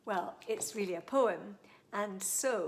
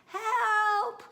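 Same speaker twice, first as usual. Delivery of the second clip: high, loud cry of help; shouted for help; 14 semitones higher, 12 dB louder than usual. Acted. Child in the dentist chair shouting for help, high and loud. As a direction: scream for rescue. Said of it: "I shouted".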